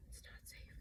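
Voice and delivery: frightened whisper. Real, not acted. Warning herself that nowhere is safe, frightened whisper. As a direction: breathe the warning like a secret.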